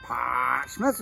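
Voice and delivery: local English accent. Shown as a demonstration. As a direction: stretch "Portsmouth" low and slow like a local. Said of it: "as they say".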